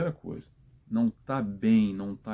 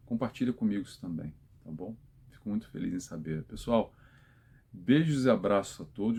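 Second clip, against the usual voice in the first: usual speaking voice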